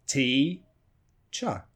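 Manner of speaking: TEACHER split into a long "tee", a gap, short "cha"; two separated syllables, "T" and "cha". Shown as a demonstration. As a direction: pull "T-cha" apart slowly. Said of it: "So you have two syllables".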